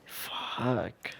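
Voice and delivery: hushed, whispered awe; soft, drawn-out whispered curse; stunned whisper. Real, not acted. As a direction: whisper it long, taken aback by the story. Stunned reaction to the dream story.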